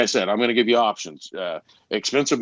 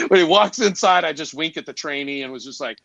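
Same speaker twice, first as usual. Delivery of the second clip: normal talking voice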